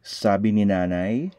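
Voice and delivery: usual speaking voice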